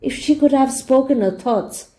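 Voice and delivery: ordinary delivery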